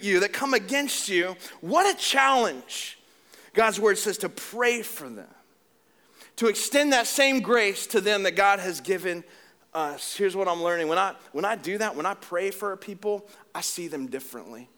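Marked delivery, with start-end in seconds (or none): none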